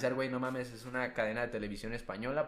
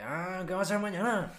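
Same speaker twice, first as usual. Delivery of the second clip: put-on fast, dragged, higher Sonoran Mexican accent; dragged Sonora accent; 9 semitones higher, 6 dB louder than usual. Friend imitating how Sonorans drag their tongue, fast Sonora accent. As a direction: slur the words quickly like someone from Sonora. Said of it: "como que bien arrastrado, güey, bien rápido".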